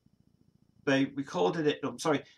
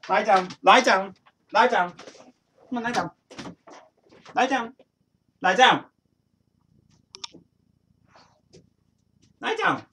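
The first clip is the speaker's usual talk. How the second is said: louder, higher repeated Lie down; firm command; 8 semitones higher, 8 dB louder than usual. Real, not acted. Owner loudly repeats the command to his restless dog. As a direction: bark the order at the dog.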